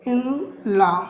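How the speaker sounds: brother in law with long pauses between words; word by word dictation. Shown as a demonstration. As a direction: dictate each word separately. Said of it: "जैसे मैंने कहा".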